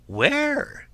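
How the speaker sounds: high piglet question; curious little pig. Acted. Pig asks wolf where turnips are, high curiosity. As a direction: ask in a high, drawn-out squeak.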